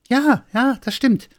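very high, excited little Santa; delighted high yes; eager agreement. Acted.

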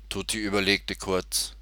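usual speaking voice

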